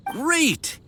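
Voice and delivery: high, eager exclamation; delighted. Acted. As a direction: pounce on the yes happily. Delighted the deal is agreed.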